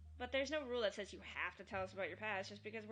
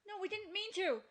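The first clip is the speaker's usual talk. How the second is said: pleading cry; 9 semitones higher, 7 dB louder than usual. Acted. Pleading denial during the quarrel.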